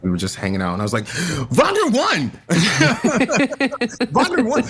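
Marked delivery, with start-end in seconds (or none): 1.5-4.6 excited shout. Retold or imitated. Telling his co-star Nesta the news, excited shout. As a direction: blurt out the big news.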